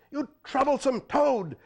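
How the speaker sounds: high loud furious curse; cursing. A narrator's reading. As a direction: spit the insult out. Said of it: "the chief cursed".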